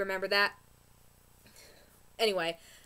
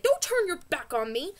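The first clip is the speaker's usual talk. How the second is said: higher, louder mock retort; mock angry retort; 6 semitones higher, 4 dB louder than usual. Rosalie yelling after Juliet, mock angry. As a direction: throw it back at her. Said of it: "And Rosalie, like".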